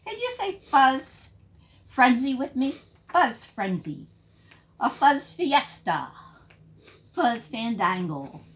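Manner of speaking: ordinary delivery, nothing marked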